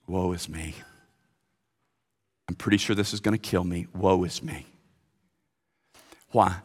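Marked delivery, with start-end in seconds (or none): 0.1-4.7 soft, low woe; Isaiah's lament. Acted. Preacher voices Isaiah overwhelmed before God, soft and low. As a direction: sink into quiet dread. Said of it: "what did Isaiah say".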